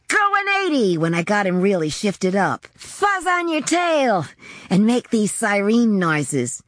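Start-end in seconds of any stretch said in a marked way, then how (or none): 0.1-1.0 high, held-out trucker holler; high-pitched whoop of a call; exuberant yell. A narrator's reading. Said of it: "I used to yell". 2.8-4.3 high, slow siren-like holler; stretched, high-pitched mock warning; exuberant yell. A narrator's reading. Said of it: "I used to yell".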